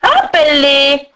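slow, drawn-out witch's order; imperious old ogress voice; commanding ogress. A narrator's reading. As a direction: order it like a menacing hag. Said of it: "ordonna Bouma".

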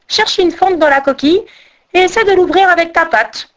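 high-pitched little bird voice; high, energetic bird character; rail bird character. A narrator's reading. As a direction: give advice in a high, pushed voice. Rail voiced, advising the crane.

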